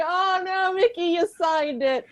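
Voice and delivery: high, giggly "oh no"; half-laughed mock alarm at the signing; laughing mock dismay. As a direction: tease him through laughter. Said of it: "And I'm like".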